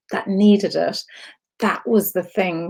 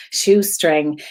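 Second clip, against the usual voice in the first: usual speaking voice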